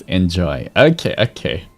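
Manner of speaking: usual speaking voice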